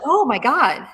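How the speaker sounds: mock-astonished exclamation; acted-out astonishment. Retelling stunned moment of recognition.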